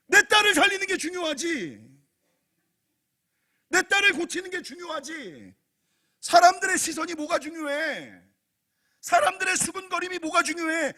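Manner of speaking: high, loud, insistent cries; fervent shouted resolve; full-force preaching. Acted. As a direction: drive each line home at the top of your voice.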